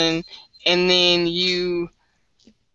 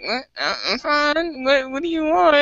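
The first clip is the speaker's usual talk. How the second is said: suspicious snap; 9 semitones higher than usual. Retold or imitated. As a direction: push the stranger away. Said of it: "I'll be like".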